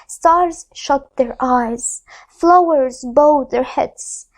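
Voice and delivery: usual speaking voice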